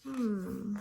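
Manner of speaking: ordinary delivery, nothing marked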